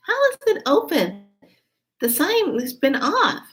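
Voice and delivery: high-pitched incredulous question; imitating her own baffled reaction; acted surprise. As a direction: retell it puzzled, voice lifting high.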